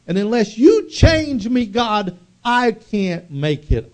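loud, high anguished plea to God; desperate cry. Acted. Jacob crying out to God.

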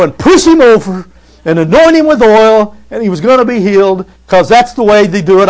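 high, loud hammed-up faith healer; mocking televangelist voice; mock TV evangelist. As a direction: ham up a TV evangelist pushing people over.

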